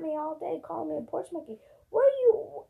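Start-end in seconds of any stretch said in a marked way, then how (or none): 1.9-2.6 indignant outburst. Real, not acted. Called a porch monkey.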